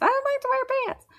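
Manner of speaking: put-on very high, fast baby voice; baby doll voice. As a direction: speak as the doll. Voicing the pantless baby doll.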